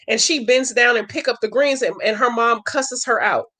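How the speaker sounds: usual speaking voice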